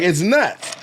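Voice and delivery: ordinary talk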